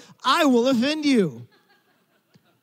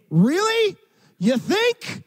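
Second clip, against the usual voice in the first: higher, dripping sarcasm; sarcastic; 10 semitones higher, much slower than usual. Acted. Preacher mocking the obvious to the congregation, sarcastic. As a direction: roll your eyes at the obvious, high and mocking.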